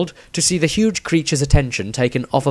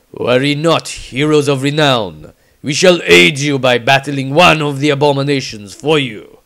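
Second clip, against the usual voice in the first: louder, proud noble voice; Bretonnian knight; 2 semitones higher, 4 dB louder than usual. Acted. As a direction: announce it like a gallant knight. Bretonnian man speaks.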